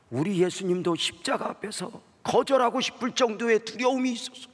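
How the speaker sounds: preaching at full force. Acted. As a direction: cry it out from the pulpit. Preaching climax.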